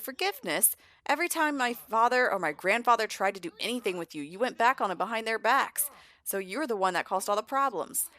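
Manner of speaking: mock-indignant, throw the blame back; indignant accusation. Caleb blames Vlad for the clan feud, indignant.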